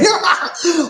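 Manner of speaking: sudden high laugh. Real, not acted. Bursts into laughter.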